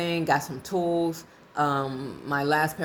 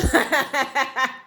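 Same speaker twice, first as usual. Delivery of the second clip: a bright burst of real laughter, higher and louder; 8 semitones higher, 4 dB louder than usual. Real, not acted. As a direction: laugh out loud at your own story. Real laugh after the story.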